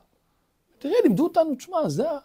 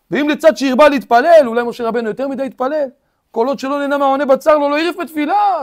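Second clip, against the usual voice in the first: loud, driving sermon voice; full-force sermon delivery; fiery preaching; 5 semitones higher, 10 dB louder than usual. Acted.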